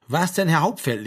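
lightly voiced sergeant supplying the proper address; correcting. Sergeant corrects missing form of address. (leicht zurechtweisend, lauter vorgesprochen)

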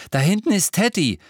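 put-on high, loud, eager boy; breathless excitement. A narrator's reading. James points back into the steam, breathless with news. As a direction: blurt out breaking news. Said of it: "sagte er atemlos".

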